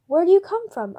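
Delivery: high, loud eager question. A narrator's reading. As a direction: lean in, keen to know.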